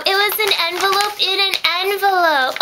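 thrilled high cry about a second envelope; excited. Real, not acted. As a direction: squeal with delight at the surprise. Excited opening of mail.

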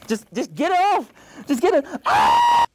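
rising panicked yell; desperate, frantic cry for help; panicked shouting. Real, not acted. Panicking, stuck in a mask.

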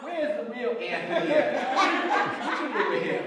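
high, loud, laughing disbelief; as if her husband's been swapped out; horrified wife. Retold or imitated. Shocked wife asking where her real husband went, laughing.

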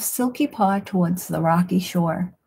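ordinary delivery

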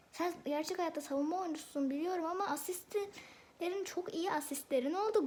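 normal talking voice

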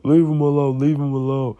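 acted yell, repeated insistently; imitating dad yelling. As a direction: shout it back as the fed-up father. Acts out dad shouting back.

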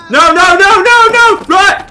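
high, very loud rapid no's; frantic high-pitched yell; panicked shouting. Real, not acted. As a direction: scream in alarm at a sudden shock. Panics at game shock.

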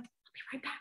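quick whispered aside. Retold or imitated. To the English mother, a quick whispered promise. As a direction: breathe it out as you rush off. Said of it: "I'm like".

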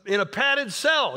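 hammed-up, high-pitched incredulous outburst; playful high exclaiming, breaking up midway; Festus. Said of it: "I love what Festus goes".

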